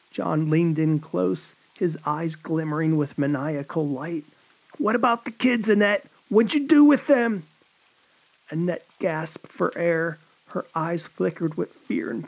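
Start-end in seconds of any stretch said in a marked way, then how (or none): 4.8-7.4 high, loud menacing interrogation; angry demand. A narrator's reading. John demanding the kids from dying Annette, furious. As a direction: bark the question at her.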